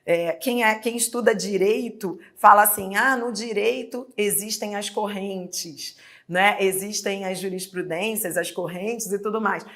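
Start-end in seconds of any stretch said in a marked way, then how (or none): none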